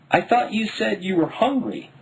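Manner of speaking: mild lament from a tired mother; lamenting mom. A narrator's reading. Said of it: "Mom lamented".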